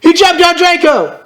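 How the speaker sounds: high, excited story climax; excited narration. A narrator's reading. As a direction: shoot the pitch up for the action. Climax of the fight.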